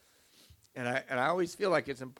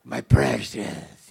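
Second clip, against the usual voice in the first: slow, louder creepy creature voice, drawn out; Gollum impression; 2 semitones lower, 10 dB louder, much slower than usual. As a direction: croon it like Gollum over his treasure. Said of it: "I'm living like Gollum".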